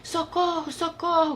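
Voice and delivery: grito agudo de socorro; pajem gritando. A narrator's reading. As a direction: grite socorro bem agudo. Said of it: "gritou o pajem". (pajem pedindo socorro aflito)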